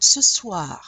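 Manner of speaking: "Ce soir" falling, loud start, R barely heard; French "Ce soir" trailing into a breathy R; dry final R. Shown as a demonstration. Teacher repeats the example, "Ce soir" with faint R. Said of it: "you can barely hear it".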